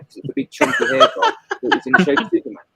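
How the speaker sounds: amused laugh running through the line. Real, not acted. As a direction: giggle it out while describing the hairbrush. Laughing recalling childhood hairbrush.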